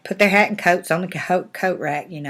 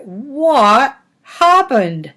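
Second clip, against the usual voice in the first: very slow, loud, high disbelief; hammed-up bewilderment; baffled bears; 6 semitones higher, 6 dB louder, much slower than usual. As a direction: stretch each word in astonishment. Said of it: "at each other like".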